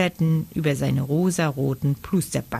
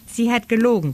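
higher, lightly squeaky protest; piping; 6 semitones higher, faster than usual. A narrator's reading. Franz tells the boy Gabi lied, piping. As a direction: protest in a small raised voice. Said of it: "piepste der Franz".

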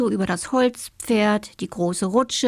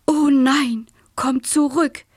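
high, louder worried papa; alarmed call; 6 semitones higher, 5 dB louder, slower than usual. A narrator's reading. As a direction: cry out after a straying child. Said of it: "rief der Marienkäfer Papa".

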